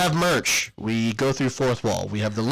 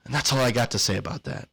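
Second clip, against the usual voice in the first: breathless after the long ad read; winded, catching breath; 2 semitones lower than usual. Real, not acted. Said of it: "I'm out of breath".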